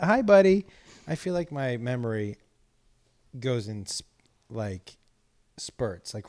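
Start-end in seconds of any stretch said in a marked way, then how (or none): 0.0-0.7 high, sing-out hello to an animal; pet greeting. Real, not acted. Host greeting a pet mid-sentence, high and affectionate. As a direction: break off and coo brightly to the dog.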